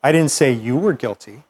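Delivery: "you" lifted in pitch and stressed. Shown as a demonstration. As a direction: punch the word "you". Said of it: "emphasize different words".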